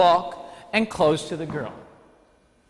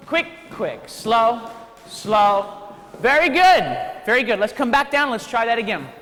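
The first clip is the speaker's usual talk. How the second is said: higher, louder, upbeat calling of the steps; energetic dance count; 6 semitones higher, 3 dB louder, slower than usual. Acted. Instructor calling steps to the dancing class, higher and louder. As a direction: pump the class up as you count the beat.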